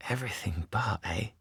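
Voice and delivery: insinuating whisper; knowing whisper. Acted. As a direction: echo it slyly under the breath.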